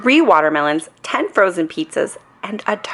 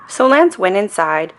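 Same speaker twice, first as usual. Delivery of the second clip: ordinary delivery, nothing marked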